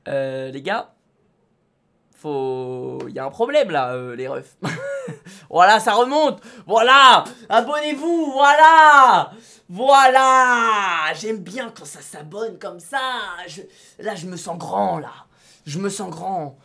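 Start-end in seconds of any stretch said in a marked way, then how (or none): 5.5-11.1 loud, triumphant voilà; excited cheer. Real, not acted. Streamer cheering his rising subscriber count, loud. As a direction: celebrate the climbing count out loud.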